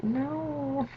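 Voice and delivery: high, drawn-out mock wail of dismay. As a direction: draw out a high, wailing "no". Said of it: "I was so sad".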